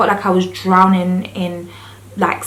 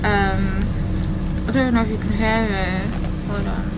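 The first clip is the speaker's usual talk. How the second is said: numb from the dentist; 6 dB quieter than usual. Real, not acted. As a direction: talk with a numb mouth after drilling. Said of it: "my mouth is still numb".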